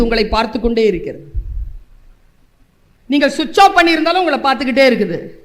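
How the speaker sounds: ordinary talk, nothing marked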